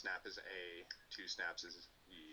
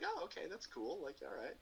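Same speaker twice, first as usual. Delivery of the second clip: mock approval; 12 semitones higher, 3 dB louder than usual. Players fake-approve the forbidden painting.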